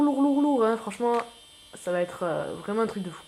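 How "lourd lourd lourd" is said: "lourd" repeated higher and louder each time; hyped repetition. Real, not acted. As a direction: pump up the word "lourd". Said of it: "du lourd". ("lourd" martelé, plus haut et plus fort)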